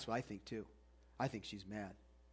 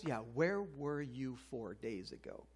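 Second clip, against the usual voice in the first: slow, resentful jab; the crowd sneering at a late friend, slow and bitter; scowling sarcasm; 4 semitones lower, 7 dB quieter, slower than usual. Retold or imitated. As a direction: mutter it with a scowl, slowly. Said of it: "can you imagine the scowls".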